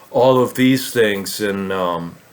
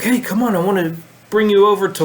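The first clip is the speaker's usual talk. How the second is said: friend calling me over; 8 semitones higher, faster than usual. Retold or imitated. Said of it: "he's like".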